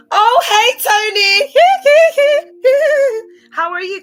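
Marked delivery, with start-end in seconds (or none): 0.1-1.5 loud, very high squealed hello; high-pitched, gushing greeting; excited greeting. Real, not acted. Greets Tony mid-stream, calling out. 1.6-3.5 loud, high drawn-out giggle; long, high cackle; high squealing laugh. Real, not acted. Laughs after greeting Tony.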